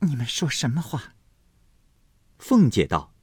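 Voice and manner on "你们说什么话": flustered. A narrator's reading. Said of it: "黛玉慌道".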